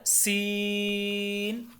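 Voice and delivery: सीन with a whistling s and long level ee; whistled, lengthened. Shown as a demonstration. Teacher introduces the whistling letter, सीन drawn long. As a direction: stretch the ee in सीन. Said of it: "तीन से पाँच अलिफ के बराबर".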